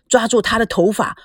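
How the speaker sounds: ordinary delivery, nothing marked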